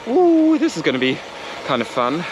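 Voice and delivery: acted thrilled ooh; playful anticipation. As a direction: squeal it as an eager spectator. Acts out viewer's anticipation.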